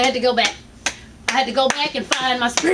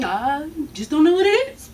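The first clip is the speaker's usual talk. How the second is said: mimicking doctor; 2 semitones lower than usual. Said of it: "Yeah, I'll go ask my colleagues".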